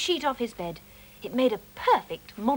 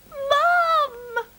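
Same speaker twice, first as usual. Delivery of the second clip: high, louder kitten meow-cry; 14 semitones higher, 7 dB louder than usual. Acted. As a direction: call out like a kitten mewing for mum. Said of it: "meowed Mog".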